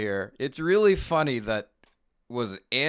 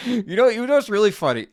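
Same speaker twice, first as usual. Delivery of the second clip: excited; 10 semitones higher, 7 dB louder, faster than usual. Real, not acted. Excited interjection, laughing.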